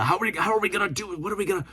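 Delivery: high, fast, panicked rambling; a hammed-up frantic Charlie voice; frantic conspiracy guy. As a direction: sputter like a frantic conspiracy nut. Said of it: "that Charlie guy".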